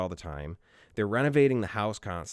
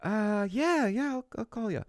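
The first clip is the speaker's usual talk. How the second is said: fake polite brush-off; 12 semitones higher than usual. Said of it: "they would go".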